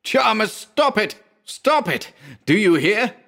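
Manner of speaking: high, urgent pleading cry; desperate plea. A narrator's reading. Narrator begs his writhing friend to stop, high and urgent. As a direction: shout to snap him out of it.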